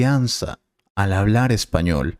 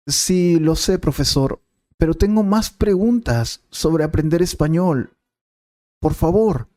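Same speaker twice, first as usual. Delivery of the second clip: higher, pleading student; 6 semitones higher than usual. Acted. Student begs the teacher to keep answering, pitched-up plea. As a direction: beg the teacher for more, pitched up.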